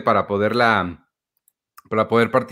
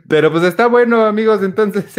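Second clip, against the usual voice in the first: excited; 9 semitones higher, 5 dB louder than usual. Real, not acted. Host turns to address viewers.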